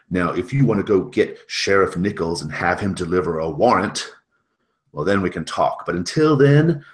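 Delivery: loud, forceful refusal; heated, loud telling-off from down the hall; arguing. Acted. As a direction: raise your voice and send them away firmly. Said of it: "having an argument".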